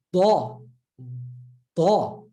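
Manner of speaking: "ba" as a heavy D, trapped then released; trapped sound. Shown as a demonstration. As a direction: build up and burst out "ba". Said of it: "I'm trapping the sound with a curved tongue".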